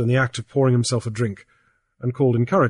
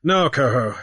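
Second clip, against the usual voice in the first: raised, lively pidgin English; Grief's pidgin voice; 6 semitones higher, 4 dB louder than usual. A narrator's reading. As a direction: talk big to the old chief in pidgin. Grief answers Coho in pidgin.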